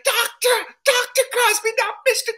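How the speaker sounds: very high, loud indignant correction; indignant Cosby impression. Comic as Cosby correcting his title, high and indignant. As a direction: squeal the correction like offended royalty.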